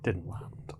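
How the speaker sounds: unvoiced whispered aside. Real, not acted. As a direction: whisper it to yourself.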